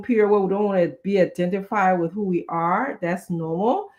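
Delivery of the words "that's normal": usual speaking voice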